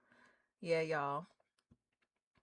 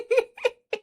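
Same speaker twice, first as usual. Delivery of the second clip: bright high giggle; 7 dB louder than usual. Real, not acted. Streamer giggling while greeting a viewer. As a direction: giggle between words. Laughing mid-phrase.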